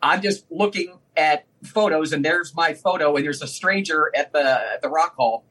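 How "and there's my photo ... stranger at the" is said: ordinary delivery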